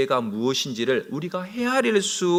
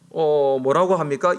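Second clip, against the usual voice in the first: ordinary talk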